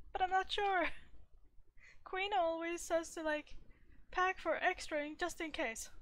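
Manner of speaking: high, worried mock voice; acted fretting. Anxious host answering the exasperated companion, high fretful mock voice. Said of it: "I'll be like".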